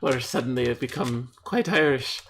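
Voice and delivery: loud Irish accent climbing high; Irish brogue. Shown as a demonstration. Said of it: "suddenly switch into an Irish brogue".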